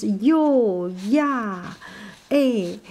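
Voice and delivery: sample particles "yo, ya, eh" voiced softly and warmly; 柔和地说"yo, ya, eh"; soft exclamations. Shown as a demonstration. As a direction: breathe out "yo, ya, eh" as gentle tags. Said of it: "something like this".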